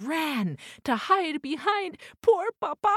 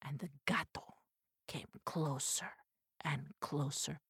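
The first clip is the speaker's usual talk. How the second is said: whispered, much softer narration; hushed suspense; 7 semitones lower, 10 dB quieter than usual. A narrator's reading. Suspense as cat approaches.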